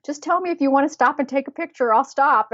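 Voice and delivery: lightly voiced kind older man; older man's offer. Italian husband offering to stop for photos, high and friendly. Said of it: "So he's like".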